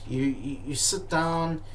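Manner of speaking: ordinary talk, nothing marked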